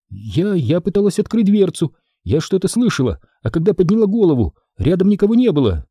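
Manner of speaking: high, fast, rattled answer; Audrey nervously explaining to Jenny; flustered fright. Acted. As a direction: stumble out the words. Audrey shaken, rushing.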